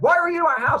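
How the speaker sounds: loud, high bellow; angry interrogating shout. Retold or imitated. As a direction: demand answers at full volume. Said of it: "starts interrogating me on the street".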